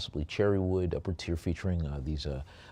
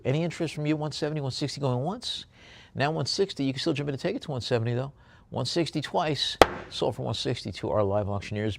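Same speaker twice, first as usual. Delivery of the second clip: higher, brisk going once, going twice; auctioneer calling bids; 6 semitones higher, faster than usual. Acted. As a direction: push the room toward the next bid.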